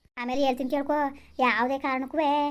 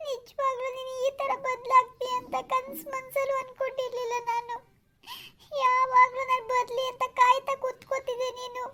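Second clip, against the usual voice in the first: tearful; 3 semitones higher than usual. Acted. Girlfriend accusing Arun of having changed, crying. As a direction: wail it out as a wounded girlfriend. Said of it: "ಯಾಕ್ ಅಳ್ತಾ ಇದೀಯಾ?".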